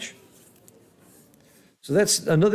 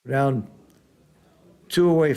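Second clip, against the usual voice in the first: normal talking voice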